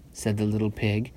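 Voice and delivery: usual speaking voice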